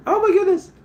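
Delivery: very high, loud falsetto squeal; fanboy squeal. Pretend. Streamer thrilled that David liked his post, squealing. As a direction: squeal it like a starstruck fan.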